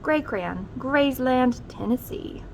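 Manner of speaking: plain reading voice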